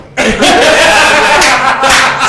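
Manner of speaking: loud laugh. Real, not acted. Cracks up at the joke.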